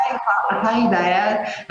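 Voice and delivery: put-on high, childlike little voice; little child. Man imitating himself as a small child, high childlike voice. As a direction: call out to Dad like a toddler. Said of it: "I used to talk like this when I was a wee child".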